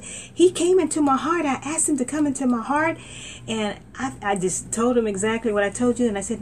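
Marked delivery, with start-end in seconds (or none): 0.0-3.0 high, eager telling; young believer witnessing on the bus. Retold or imitated. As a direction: lean in bursting to share.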